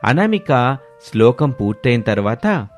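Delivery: plain reading voice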